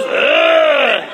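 loud retching growl; retching arr. Retold or imitated. Drug coming back up, loud retching growl. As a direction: heave it out with a growl.

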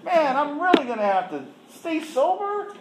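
high, loud mock whine; whiny protest. To the counselor, whining about going back to meetings. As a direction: complain like a sulky kid. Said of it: "she said".

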